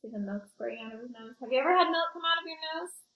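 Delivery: usual speaking voice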